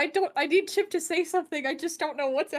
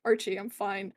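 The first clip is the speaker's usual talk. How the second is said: gentle reassurance; 5 semitones lower than usual. Acted. First calm reassurance to Archie.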